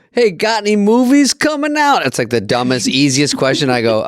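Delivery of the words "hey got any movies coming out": nosy fan. Retold or imitated. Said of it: "I get a guys go".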